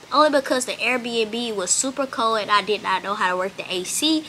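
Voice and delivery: usual speaking voice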